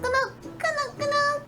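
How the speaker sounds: high squeak for each nod; squeaky high voice. Acted. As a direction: chirp it up high. Squeaky nodding.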